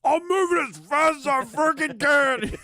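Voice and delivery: high, strained yell; high frantic toilet dad. Retold or imitated. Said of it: "dad on the toilet was like".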